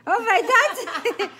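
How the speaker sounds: high, loud, laughing squeal; delighted laughing. Real, not acted. Delighted by toddler's answer.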